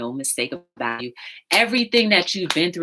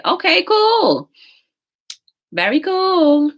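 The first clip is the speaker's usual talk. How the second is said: high sing-song; 13 semitones higher, 7 dB louder, slower than usual. Acted. Sudden sing-song after sign-off.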